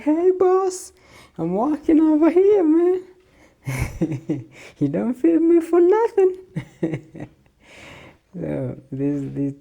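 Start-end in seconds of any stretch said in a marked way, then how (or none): 0.0-6.4 much higher, louder cartoon dog voice; voicing the dog. Dog greeting owner at the door, high loud cartoon voice. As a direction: squeal it like an excited pet. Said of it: "She's like".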